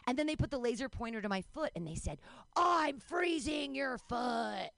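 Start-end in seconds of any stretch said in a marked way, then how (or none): 2.6-4.7 higher, very drawn-out mock threat; menacing child torturer. Twins aiming a laser pointer at nanny's foot, drawn-out mock menace. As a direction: drag out every word like a playacting villain.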